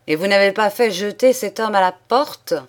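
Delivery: s'exclamer, plus fort et scandalisé; exclamation indignée. A narrator's reading. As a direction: lancer la question avec indignation. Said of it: "s'exclama le comte". (le comte apprend que son fils n'a pas chassé l'homme, indigné)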